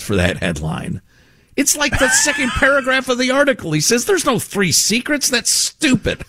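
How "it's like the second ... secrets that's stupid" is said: mocking disbelief. Real, not acted. Mocking the headline, high voice.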